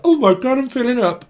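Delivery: high mock-weepy gushing; mock tearful gush. Psychic imitating moved viewers, mock tearful. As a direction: ham up a viewer welling up.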